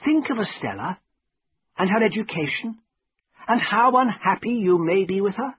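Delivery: high-pitched, earnest pleading; serious. A narrator's reading. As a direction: appeal to a friend in earnest, pitch lifted high. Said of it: "looking serious for once".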